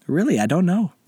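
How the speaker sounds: high-pitched, simple denial; simple, innocent answer. A narrator's reading. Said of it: "Anderson said simply".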